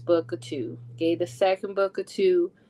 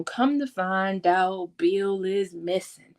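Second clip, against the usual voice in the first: normal talking voice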